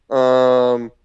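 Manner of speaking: long, loud held "um"; mock hesitation. Trainee told to hit her right here, mock hesitation. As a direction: stall loudly, acting out reluctance.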